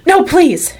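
high, loud frightened plea. Acted. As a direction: beg in sudden fright. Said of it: "very frightened".

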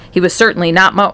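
usual speaking voice

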